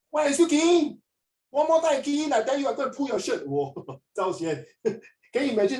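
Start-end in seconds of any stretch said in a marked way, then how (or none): 0.1-3.5 mock an irate machine telling you off; a hammed-up rude robot at the kiosk; angry robot voice. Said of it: "ah, the robot say".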